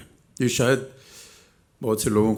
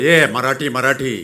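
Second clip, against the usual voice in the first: loud, high mock heckle; mimicked crowd yell, pitched up; imitated audience shout; 5 semitones higher, 8 dB louder than usual. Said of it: "इधर ही जनता से आवाज़ आई".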